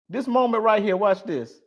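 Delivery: ordinary delivery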